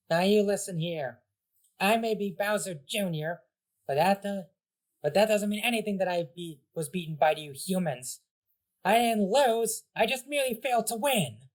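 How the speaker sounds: high, puffed-up bratty voice; a defeated brat saving face; indignant kid villain. Acted. Bowser Junior lecturing the heroes.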